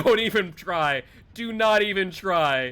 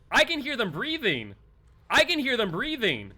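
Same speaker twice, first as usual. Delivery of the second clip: higher, tense warning; scared callout to team; panicked whisper-alarm; 7 semitones higher than usual. Real, not acted. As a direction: sound spooked, urgent. Hearing killer breathe nearby.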